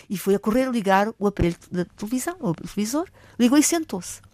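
usual speaking voice

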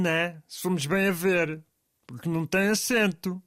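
ordinary talk, nothing marked

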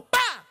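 sharp high slap noise, one burst; imitated slap. Storyteller mimicking a slap on the waiter, sharp smack.

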